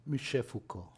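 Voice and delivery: Michel Foucault in a French accent, /miʃe fuko/. Shown as a demonstration. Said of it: "Cántame ese acento de nuevo, ese acento francés". (con acento francés)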